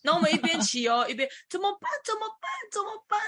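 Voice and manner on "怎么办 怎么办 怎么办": high, frantic repeated what now; mock-frantic squeal while riding; retold panic. Riding onto the expressway by mistake, panicking high.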